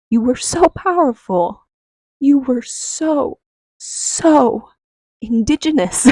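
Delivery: overwhelmed awe with a laugh. Real, not acted. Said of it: "awe, like, just awe of my ancestors".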